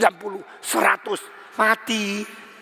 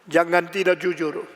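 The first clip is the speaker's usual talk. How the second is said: ordinary talk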